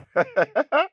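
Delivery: high, rising cackle; incredulous laughter. Acted. Son reacts to the chosen name, high mocking laugh. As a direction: break into a high laugh of disbelief.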